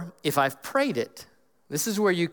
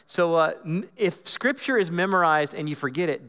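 ordinary talk

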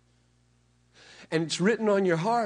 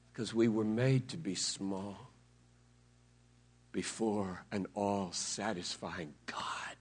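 slow, soft awed whisper; 11 semitones lower, 7 dB quieter than usual. Acted. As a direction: let it sink to a hush. Awed answer falling to whisper.

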